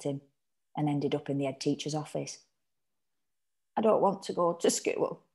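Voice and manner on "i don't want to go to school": plain reading voice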